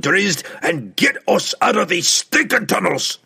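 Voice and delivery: high, loud dwarf's rant; furious gruff dwarf tirade; angry ultimatum. A narrator's reading. Dwarf king gives angry ultimatum.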